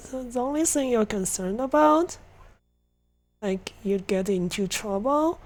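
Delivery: ordinary delivery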